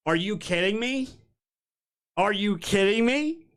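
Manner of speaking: incredulous, drawn out and climbing at the end; exasperated disbelief. Real, not acted. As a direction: ask it like you can't believe it. Exasperated disbelief at pundits' sources.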